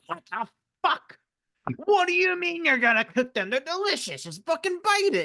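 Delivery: high, strained Gollum screech. Retold or imitated. Gollum shrieking at Sam about cooking. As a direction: squeal it like an outraged Gollum. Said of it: "And Gollum's like".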